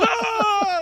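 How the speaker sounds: long, very high scream; re-enacted excited scream. Host reliving the trailer reveal, hysterical scream. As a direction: keep shrieking with fan hysteria. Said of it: "screaming at the top of my lungs".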